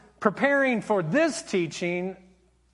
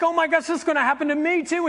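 high, fast mock panic; retold panic, pitched up and quick; freaked out; 8 semitones higher, 3 dB louder, faster than usual. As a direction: imitate a freaked-out kid, rushed and high. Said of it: "she gets so freaked out".

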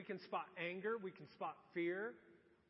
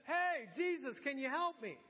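calling out higher and louder from the back of a crowd; raised-voice mock holler; imitated yell; 5 semitones higher, 6 dB louder than usual. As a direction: act out a man yelling for help. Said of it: "and he yells out".

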